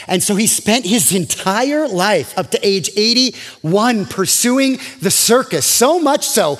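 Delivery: preaching at full force. Acted. Preaching climax.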